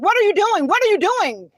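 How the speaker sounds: imitated officer demanding. Officer banging on the car, imitated insistent demand. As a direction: lightly act out an officer's repeated demand.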